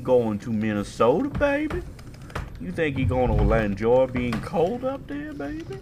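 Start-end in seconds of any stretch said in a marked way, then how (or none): 1.3-1.8 put-on sudden much higher cooing baby; teasing coo. Teasing Ryan with a high mock coo. 2.7-5.8 put-on sudden much higher cooing baby; teasing coo. Teasing Ryan with a high mock coo.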